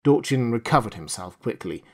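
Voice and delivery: plain reading voice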